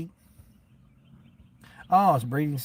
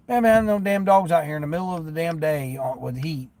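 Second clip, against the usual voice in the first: ordinary delivery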